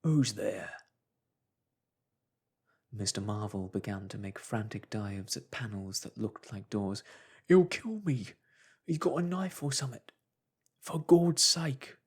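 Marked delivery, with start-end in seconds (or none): 0.0-0.7 louder challenge at the door; a hint of a wary policeman; lightly voiced policeman. 7.5-12.0 much higher, loud, slowing desperate cry; terrified man begging for rescue; panicked terror. Acted. Marvel panics.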